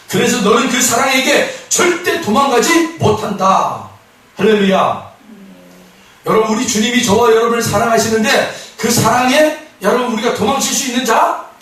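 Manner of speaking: loud, high preacher's exhortation; ringing pulpit declaration; full-force preaching. Real, not acted.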